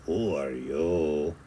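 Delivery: put-on low, soft growled Spanish accent; growling bandit. A narrator's reading. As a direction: growl it like the gang leader. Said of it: "growled Don Diego".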